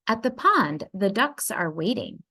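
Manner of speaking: plain reading voice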